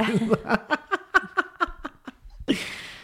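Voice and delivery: high burst of laughter; laughing. Real, not acted. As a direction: laugh at your own joke. Laughs at own joke.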